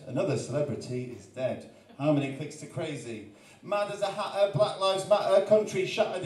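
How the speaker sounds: rhythmic spoken word with a repeated question hook; performed poem. Acted. Poet reciting his YouTube poem to the crowd, rhythmic. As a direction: recite it with a steady beat.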